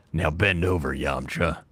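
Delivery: low-pitched character voice; Steve Blum. Acted. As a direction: drop into a deep voice-actor impression. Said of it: "The Steve Blum one is better?".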